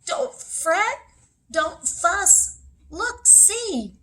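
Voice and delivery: high little mouse voice; squeaky mouse. A narrator's reading. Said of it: "Mouse squeaks".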